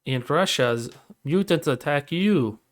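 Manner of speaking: put-on slow, heavy Russian accent; Yakov Smirnoff Russian accent. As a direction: joke in a thick Russian accent. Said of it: "their best Yakov Smirnoff impression".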